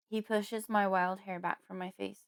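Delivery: plain reading voice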